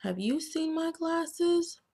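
high-pitched little bookworm voice; worried bookworm asking. Acted. Bookworm asking everyone about his lost glasses, high and worried. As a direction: ask it up high like a small worried worm. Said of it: "Carney asks".

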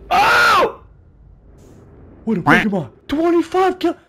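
high, loud alarmed cries; shocked shouting; startled yelling. Real, not acted. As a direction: yelp as the ground gives way. Startled while falling in game.

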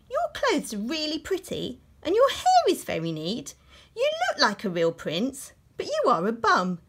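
high, loud mock-sweet praise; sweet then blunt put-down; sarcastic princess.

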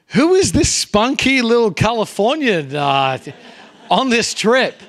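high, loud astonished exclamation; amazed first impression. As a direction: marvel out loud at the energetic newcomer. Remember thinking on meeting pastor.

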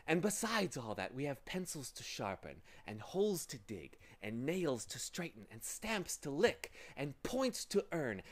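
lightly wheedling, coaxing villain; pleasing, deceitful voice. A narrator's reading. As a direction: lure them in with false sweetness. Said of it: "soft, pleasing, deceitful voice".

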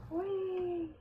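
high, gleeful little whee. Real, not acted. Playing the game alone, a high gleeful whee. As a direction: let out a delighted squeal of fun. Playful cry while playing.

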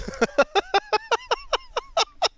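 high drawn-out cackle; long laughing fit. Real, not acted. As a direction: laugh hard and long at the scene. Laughing at the midget chase.

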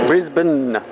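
Brisbane said BRIZ-bn, ending swallowed; short Australian Brisbane; Aussie Brisbane. Shown as a demonstration. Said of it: "B R I S B N".

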